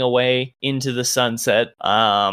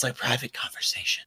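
a hushed aside, nearly no voice; whispered aside; 9 dB quieter than usual. Real, not acted. DM whispering to the player that this is a private talk. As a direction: lean in and whisper it like a secret.

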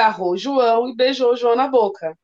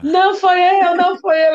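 mock crying child; 6 semitones higher, 4 dB louder than usual. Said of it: "Ela chorando".

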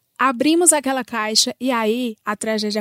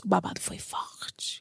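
whispering; 5 semitones lower, 12 dB quieter than usual. Real, not acted. Gossip punchline whispered.

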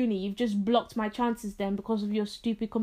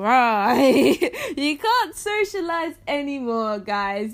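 laughing; 3 semitones higher, 6 dB louder, slower than usual. Real, not acted. Laughing mock announcement.

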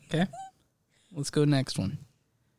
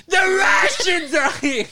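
high, loud, drawn-out Bronx screech; Italian Bronx woman; 4 dB louder, slower than usual. Acted. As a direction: scream like a hammed-up Italian Bronx mom. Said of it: "I'm gonna do that voice".

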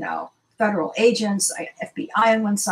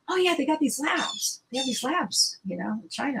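higher, lighter imitation of a casual neighbor; breezy explaining; 5 semitones higher, 4 dB quieter than usual. Neighbor casually explains the labs to her, breezy. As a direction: voice her offhand answer, pitched up.

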